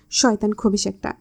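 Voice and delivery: ordinary delivery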